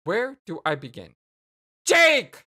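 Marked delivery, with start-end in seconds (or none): 1.8-2.4 high, loud yell of his name; exasperated shout. Acted. Jane breaking into Jake's long ramble, exasperated shout. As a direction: cut in with a fed-up shout.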